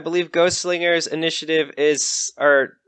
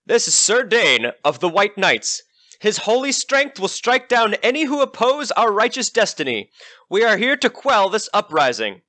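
proud, devoted squire announcing his master; a lightly voiced fanatical squire; zealous squire; 6 semitones higher than usual. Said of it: "eyes filled with admiration for the undead knight".

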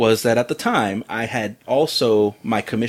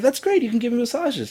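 eager; 12 semitones higher than usual. Retold or imitated. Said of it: "I was like".

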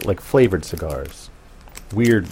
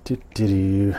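normal talking voice

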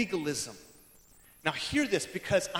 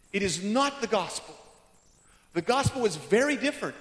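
normal talking voice

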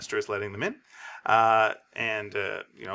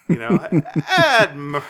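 laughing through it; 4 semitones higher, 7 dB louder, much slower than usual. Acted. Captain laughing while reading.

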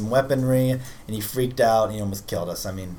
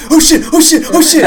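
high, loud cries of alarm; panicked shout; 15 dB louder than usual. Acted. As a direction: shriek it as the danger hits. Sudden zombie alarm in game.

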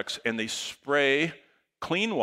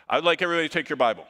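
fast, loud, eager preacher instruction; high-energy fast announcement; excited; 6 semitones higher, 6 dB louder, much faster than usual. Retold or imitated. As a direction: rush it out with excitement. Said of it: "I get very excited".

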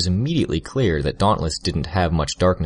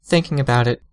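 Tattletale voice; 7 semitones higher, 4 dB louder than usual. A narrator's reading.

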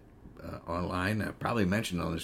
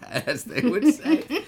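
laughing aside; 7 dB louder, much slower than usual. Real, not acted. Joking about a drawl.

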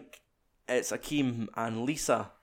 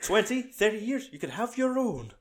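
lightly voiced patronising host, pitched higher; patronising rich man; 9 semitones higher than usual. Mocking the host's promise to a servant, lightly patronising voice. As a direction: play a condescending host, lightly. Voicing the patronising film character.

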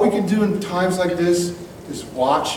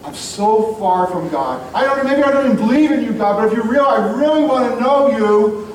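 heartfelt prayer; 6 semitones higher than usual. Pretend. Sinner praying to God, earnestly pleading. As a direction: plead with God to take you back. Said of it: "where you said".

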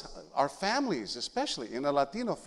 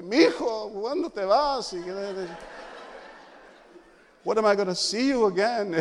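imitating a weeping mother, higher and louder; tearful mother; 7 semitones higher, 5 dB louder than usual. Son imitates his mother crying in the driveway, high tearful plea. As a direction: wail it like a tearful mom at the door. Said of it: "my mother's in tears".

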